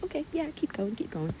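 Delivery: high mocking sing-song; sarcastic high "keep going" aimed at the manager; sarcastic go-ahead. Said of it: "and I'm like".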